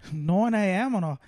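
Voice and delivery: mock Australian accent. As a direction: protest like an Aussie fan. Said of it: "That's not a bad Australian".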